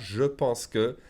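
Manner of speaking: "je pense que" with a full "je"; read as written. Shown as a demonstration. Teacher quotes the classroom version, "je pense que" uncontracted. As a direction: sound every word of "je pense que". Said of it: "That's how you read it".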